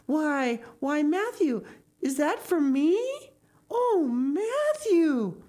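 put-on high, breathtaken girl, stretching the last exclamation; overwhelmed young girl seeing her gift; astonished Anne.

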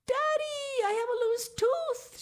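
put-on high, loud child's shout; excited shout. A narrator's reading. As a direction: call out to daddy from afar. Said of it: "she shouted".

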